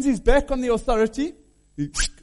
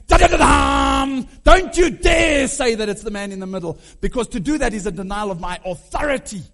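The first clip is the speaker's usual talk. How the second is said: defiant shout; 7 dB louder than usual. Acted. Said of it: "standing up and going".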